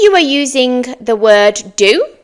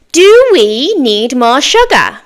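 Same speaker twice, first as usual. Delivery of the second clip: slow model question; 3 semitones higher, 3 dB louder, slower than usual. Shown as a demonstration. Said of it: "Your turn".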